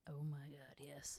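low, near-whispered swoon; mock-private murmur of approval; hushed delight. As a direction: act secretly thrilled, very softly. Acting secret delight at TikToks.

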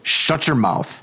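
a father losing his temper at the neighbor; angry order. Acted. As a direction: shut him up with cold fury.

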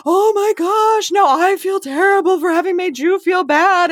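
high mocking imitation of Teresa. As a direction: parody a gushing fake apology. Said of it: "Teresa goes into the".

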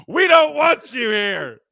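high, loud, slow mock yell; Lithgow yelling at Harry. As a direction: yell at the Bigfoot to leave. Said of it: "John Lithgow yelling at Harry".

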